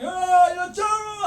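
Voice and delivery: high, loud mocking yell; mock screaming man. The bellowing man cursing the teller, high mock yell. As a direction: squeal it like an angry man. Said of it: "So he was going".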